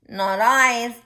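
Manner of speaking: a loud goose refusing. Acted. Goose turning down the hen, loud. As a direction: honk it out loud.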